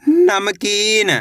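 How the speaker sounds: नमकीन read very slowly, syllable by syllable; drawn-out reading. Shown as a demonstration. Teacher slowly sounds out नमकीन for children. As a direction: stretch out नमकीन slowly for pupils. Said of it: "कैसे पढ़ेंगे?".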